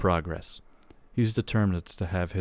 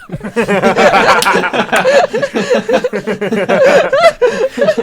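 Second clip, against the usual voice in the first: loud, high cackling laugh; long helpless burst of laughter; uproarious laughter; 15 dB louder than usual. Real, not acted. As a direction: crack up completely at your own joke. Laughs at his own punchline.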